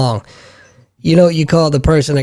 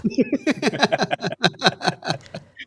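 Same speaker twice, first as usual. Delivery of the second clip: a high, airy burst of laughter at his own joke; dad laughing at his own punchline; 8 semitones higher, 9 dB quieter than usual. Real, not acted. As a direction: let a light laugh escape, pitched up.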